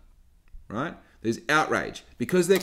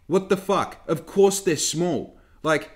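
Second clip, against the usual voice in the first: ordinary delivery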